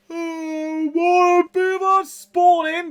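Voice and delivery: high, slow, drawn-out delight; excited. Real, not acted. Streamer greeting newly spawned beavers, high and drawn out. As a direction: sing it out in glee.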